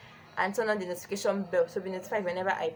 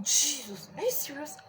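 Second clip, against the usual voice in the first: higher, disbelieving mock shock; friend's stunned reply, higher; 7 semitones higher than usual. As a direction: imitate his shock, pitched up. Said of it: "he was so shocked".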